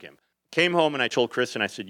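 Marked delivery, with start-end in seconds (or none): none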